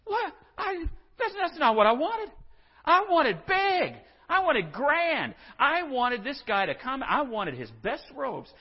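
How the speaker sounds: put-on high, petulant two-year-old whine; whiny tantrum. Preacher mocking Naaman as a tantruming toddler, high and whiny. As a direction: whine like a spoiled toddler who didn't get his way. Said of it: "He goes".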